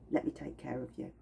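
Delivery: quiet, tender promise; soft caring reassurance. Acted. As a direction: softly soothe the listener. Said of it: "Cedarwood goes".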